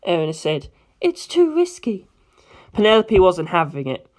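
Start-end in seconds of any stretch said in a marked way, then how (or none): 1.0-2.1 put-on a light, worried older-sister tone; Erina's voice. Erina warns Penelope.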